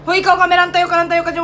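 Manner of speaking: loud call across the set. Acted. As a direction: shout at the cameraman. Yelling at cameraman.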